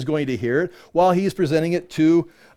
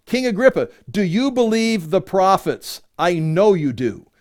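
scripture reading; 4 semitones higher than usual. Acted. Paul challenging King Agrippa about the prophets, emphatic scripture reading. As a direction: press the question home deliberately. Reads Paul's challenge aloud.

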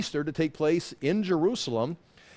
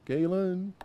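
drawn-out call, higher than your own voice; imitated calling of a name; 4 semitones higher, 4 dB quieter than usual. She interrupts him struggling with math, imitated calling voice. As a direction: mimic someone calling out, pitched higher. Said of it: "you know, she goes".

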